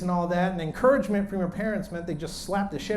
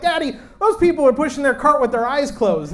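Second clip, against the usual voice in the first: put-on higher, louder little-boy voice; child yelling; 5 semitones higher, 5 dB louder than usual. Five-year-old to dad in Costco, yelling. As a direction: call out like an indignant small boy. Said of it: "he starts yelling at people".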